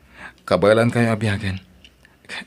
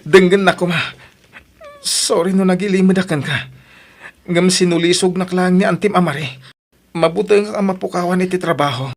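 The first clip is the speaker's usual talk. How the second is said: loud, higher pleading to be heard; desperate pleading; 7 semitones higher, 5 dB louder than usual. Acted. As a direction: beg her to listen, voice raised. Husband begs wife to listen.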